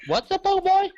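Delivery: high-pitched baffled echo; hammed-up incredulous question; mock disbelief. Acted surprise at the question.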